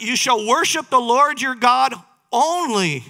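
loud, high-pitched preaching quote; forceful declaration. Acted. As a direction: lay down the commandment with ringing emphasis. Jesus's answer to the devil, acted.

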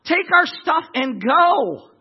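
acted impatient send-off; urgent urging. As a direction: send them off with slow, rising insistence. Egyptians urging the slaves out.

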